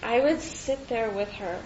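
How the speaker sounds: usual speaking voice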